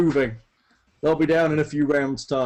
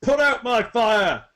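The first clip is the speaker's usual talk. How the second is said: high, drawn-out lordly command; Tybalt's shouted order; 8 semitones higher, 4 dB louder, slower than usual. Acted. Lord ordering a bystander to fight the fire, shouted order. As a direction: shout it slowly as an imperious demand. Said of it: "Tybalt points at Hieronymus".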